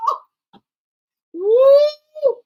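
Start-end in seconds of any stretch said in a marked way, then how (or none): 1.3-2.3 loud, high "woo" of amazement; high whoop. Real, not acted. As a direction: let out a shrill whoop. Reacting to the threat.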